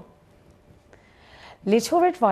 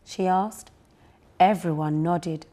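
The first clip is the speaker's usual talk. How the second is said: plain reading voice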